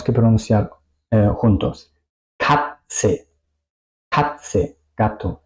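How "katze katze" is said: "Katze" said "kat-se", long hissed S. Shown as a demonstration. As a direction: say "Katze" with T released into S. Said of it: "como una T y una S". ("Katze" con T y S, "kat-se")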